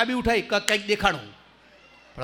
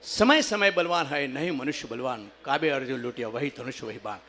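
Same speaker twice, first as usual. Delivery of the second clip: recited couplet; 7 semitones lower than usual. Shown as a demonstration. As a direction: recite the verse solemnly.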